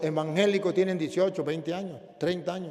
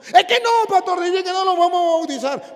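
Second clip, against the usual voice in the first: much higher and louder woman's voice; insistent mother; 14 semitones higher, 11 dB louder than usual. Retold or imitated. The mother answers.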